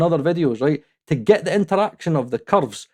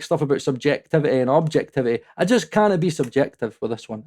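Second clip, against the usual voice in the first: ordinary talk, nothing marked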